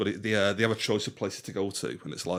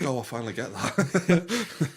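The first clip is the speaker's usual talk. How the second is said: slow mock realization; 6 semitones higher, 4 dB louder, slower than usual. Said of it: "It's like".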